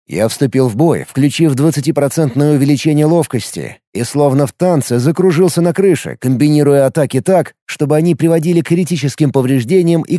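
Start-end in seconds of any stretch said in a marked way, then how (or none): none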